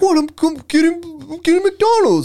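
a caught man's high flustered excuse to the host; squeaky caught-guy voice. Retold or imitated. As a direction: squeak it out like a busted man playing dumb. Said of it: "he's like".

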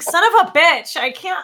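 high, loud frustrated cry; frustrated outburst. Retold or imitated. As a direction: burst out in mock frustration.

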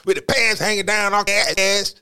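put-on high, loud scolding old lady; grandmother voice. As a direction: nag like a disapproving grandma. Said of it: "that was my imitation of a, of a grandmother".

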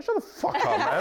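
high-pitched, exasperated mock outburst; mock-angry heckle. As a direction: heckle your younger self in disbelief. Future self heckling the video.

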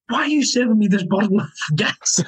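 mock disbelief breaking into laughter; high, laughing imitation of a baffled customer; astonished. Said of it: "he was astonished".

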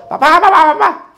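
put-on very high, loud kid's cry for dad; small child calling. Preacher's little son running round the pulpit, calling out high and loud. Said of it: "empezó a correr el niño".

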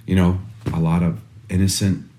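soft apology. Retold or imitated. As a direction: comfort the crying son quietly. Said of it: "I'm like".

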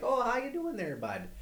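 put-on friendly Canadian accent, pitched high; chirpy Canadian greeting, eh; Canadian dude.

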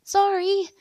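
high-pitched, loud apology; startled shout. A narrator's reading. Woman who just ran into a stranger, shouting apologies. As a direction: cry out as you reel backwards. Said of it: "sending me reeling backwards and shouting".

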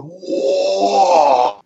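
high drawn-out crowd whoa; imitating a stunned crowd; crowd gasp. Wrestler voicing the amazed fans, high whoa. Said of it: "The fans are all going".

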